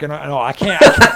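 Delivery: normal talking voice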